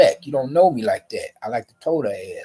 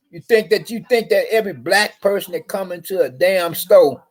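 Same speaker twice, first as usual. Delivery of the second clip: higher, louder indignant questions; indignant outburst; 6 semitones higher, 4 dB louder than usual. Real, not acted. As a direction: fire accusing questions at her. Indignant tirade at guard.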